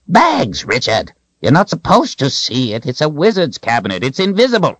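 high-pitched wizard's exclamation; exasperated. A narrator's reading. Wizard tells Richard off, high exasperated outburst. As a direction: scold him in a high, indignant voice.

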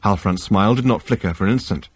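plain reading voice

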